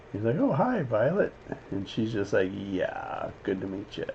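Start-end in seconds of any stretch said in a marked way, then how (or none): 0.4-1.3 higher, softer, lightly put-on hello; casual acted greeting. Acting out the greeting to Violet. 2.5-4.1 low, very soft acted mumble; embarrassed reply. Acting mortified Violet's reply.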